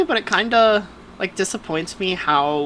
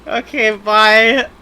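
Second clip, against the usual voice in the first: mock sulky goodbye; 5 semitones higher, 7 dB louder, slower than usual. Kicked off the podcast joke.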